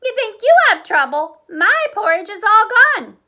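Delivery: put-on high, loud childish wail; wailing baby bear. Baby Bear wailing over his empty bowl, high and loud. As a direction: whine it big like a little cub. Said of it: "Baby Bear wailed".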